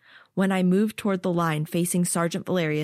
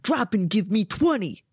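barked order; barking gym coach; 3 semitones higher than usual. Acted. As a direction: bark the push-up order. Sergeant ordering push-ups.

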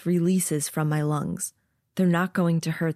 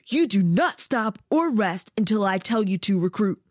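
stern sergeant; 3 semitones higher than usual. Acted. Sergeant rebuking resting recruit.

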